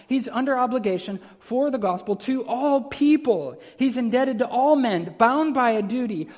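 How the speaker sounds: emphatic preacher, pressing every phrase; fervent sermon delivery, building through the list; impassioned preaching. Real, not acted. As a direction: preach it with force, weighting the key words. Preaches with rising force.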